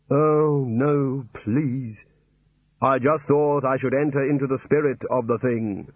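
hollow, measured voice of Death; Death's voice. Acted. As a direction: speak as Death, dry and solemn. Death with mock Aussie greeting.

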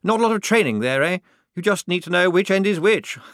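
high, nervous officer with a giggle. A narrator's reading. Lieutenant making light of pike training, nervously. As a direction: joke uneasily, far too chirpy. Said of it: "said the lieutenant nervously".

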